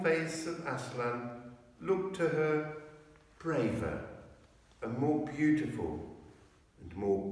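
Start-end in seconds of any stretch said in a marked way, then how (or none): none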